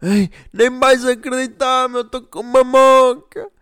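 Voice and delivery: put-on high, slow boastful drunk voice; fake drunk. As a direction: drawl out a bragging fake drunk. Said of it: "havia alguns desses personagens".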